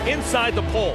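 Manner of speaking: ordinary talk, nothing marked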